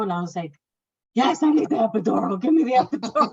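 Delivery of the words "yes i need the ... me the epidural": higher, louder, pleading demand; desperate demand. Retold or imitated. Mother in labour demanding the epidural, loud comic plea.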